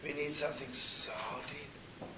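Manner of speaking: slow, breathy preacher's whisper; whispered slowly to the congregation; emphatic whisper. Acted. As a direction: draw the room in with a slow whisper.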